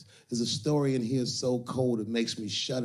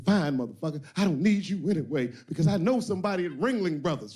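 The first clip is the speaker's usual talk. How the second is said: higher, louder mock woman talking back; sassy woman; 6 semitones higher, 3 dB louder than usual. Woman telling the pimp she's leaving, higher. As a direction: snap back like the fed-up woman. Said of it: "She said".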